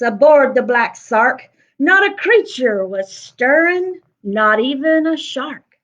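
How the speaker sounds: lively rhyming read-aloud; story narration. Acted. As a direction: read it with a bouncy rhythm to children. Reads the picture book aloud.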